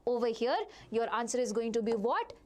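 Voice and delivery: usual speaking voice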